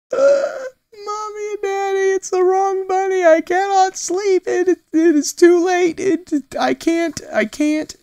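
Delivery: put-on high, loud distressed little girl; upset toddler. Toddler pleading with parents about the wrong bunny, high and loud. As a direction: wail like a toddler who can't sleep.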